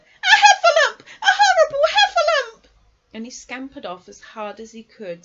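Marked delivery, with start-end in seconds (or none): 0.0-2.6 shrieking, very high cries for help; panicked Piglet. A narrator's reading. Piglet seeing the Heffalump, panicked high shriek. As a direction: squeal at the top of your voice. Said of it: "cried Piglet".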